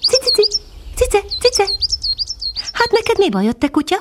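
very high, tiny chirping voice; a little bird calling down sweetly; high tiny titmouse voice; silvery little bird voice. A narrator's reading. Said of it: "szólalt meg egy ezüstös hangocska".

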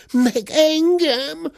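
very high, drawn-out wail; high squealing protest; yelling cub. A narrator's reading. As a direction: wail it slowly in a little bear's voice. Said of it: "ordította Zebike".

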